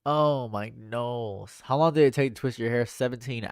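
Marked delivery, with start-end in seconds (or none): none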